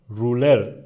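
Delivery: "رولر" with a drawn-out oo; long u. Shown as a demonstration. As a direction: stretch the first vowel of "رولر". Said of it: "اوی کشیده هست". (تلفظ «رولر» با اوی کشیده)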